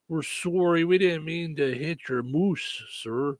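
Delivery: put-on slow, drawn-out Canadian accent; hammed-up polite Canadian; mock Canadian. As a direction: apologize like a folksy Canadian, stretching the vowels. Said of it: "'cause it's Canadian".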